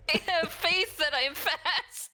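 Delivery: giggling through a slow, high joke; laughing. Real, not acted. Player joking with the game master, laughing. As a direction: laugh while cracking the joke. Joking about fast faith stat.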